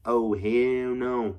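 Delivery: drawn-out "hell no"; slow, drawn-out mock refusal; emphatic refusal. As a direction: stretch out a retold refusal, slowly. Acted refusal in retold story.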